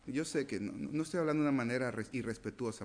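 ordinary talk, nothing marked

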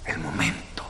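El momento whispered, breath only, no voice. Shown as a demonstration. Preacher drawing the congregation close, whispered.

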